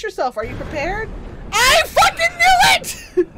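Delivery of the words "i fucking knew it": high, loud panicked shriek; terrified scream. Real, not acted. Gamer shrieking as the swamp-monster wife lunges. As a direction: scream as she jumps out.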